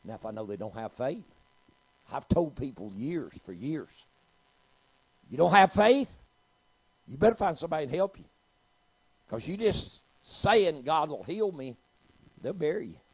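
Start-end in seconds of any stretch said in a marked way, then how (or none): 5.3-13.0 loud, high-pitched preacher's rebuke; forceful warning. Retold or imitated. Preacher warning people who lack faith, loud and forceful. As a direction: belt it out as a blunt warning.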